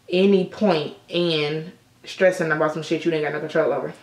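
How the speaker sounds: ordinary talk, nothing marked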